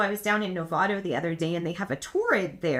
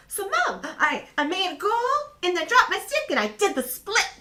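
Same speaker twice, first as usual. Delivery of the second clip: excited daughter; 10 semitones higher than usual. Retold or imitated. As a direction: brag like a proud little girl. Voicing her excited daughter.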